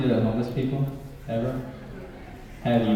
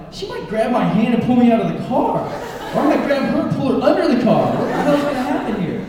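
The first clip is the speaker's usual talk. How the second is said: high laughing mock panic; panicked thoughts; 10 semitones higher, 4 dB louder than usual. Imagining the handoff, high laughing mock panic. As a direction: spiral into giggling alarm. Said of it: "I'm like".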